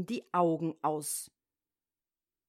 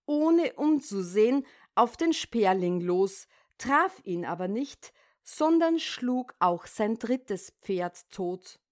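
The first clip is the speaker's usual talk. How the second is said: ordinary delivery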